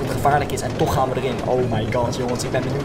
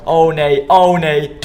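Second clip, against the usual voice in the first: loud panicked oh no; 3 semitones higher, 7 dB louder, much slower than usual. Real, not acted. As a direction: yelp in alarm. Coaster going wrong.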